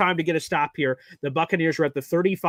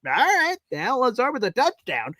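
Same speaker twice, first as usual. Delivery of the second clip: excited; 9 semitones higher than usual. Real, not acted. Hype over Lazard touchdown.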